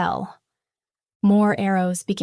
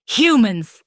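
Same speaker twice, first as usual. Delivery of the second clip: loud, spat-out hatred; 3 semitones higher, 8 dB louder than usual. A narrator's reading. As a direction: spit the word out. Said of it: "he spat".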